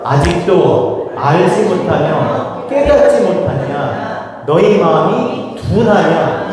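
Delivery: slow, deliberate scripture reading; slow emphatic reading. Shown as a demonstration. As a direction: read it slowly, giving each phrase weight. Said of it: "중요한 건 천천히 읽어야 돼". (천천히 또박또박 성경 읽기)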